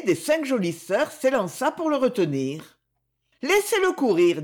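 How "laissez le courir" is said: put-on higher young woman's voice; eldest sister. A narrator's reading. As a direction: hold him back gently. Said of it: "dit-elle".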